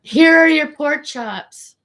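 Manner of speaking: high, slow, loud server's line; high sing-song server voice. Retold or imitated. Serving customers at the restaurant, high sing-song voice. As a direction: chirp it out like a bored waitress. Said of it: "And I'm like".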